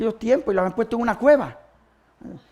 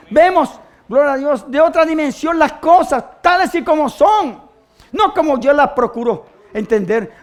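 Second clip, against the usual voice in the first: slow, raised preacher voice; fervent preaching; 5 semitones higher, 8 dB louder, slower than usual. Acted. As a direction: stretch the key words with conviction. (predicación fervorosa, alargando las palabras)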